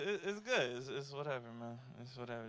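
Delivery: normal talking voice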